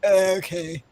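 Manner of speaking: noncommittal producer's drawn-out reply; put-on voice of an unimpressed boss; Lorne Michaels. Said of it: "That was the perfect Lorne Michaels impression".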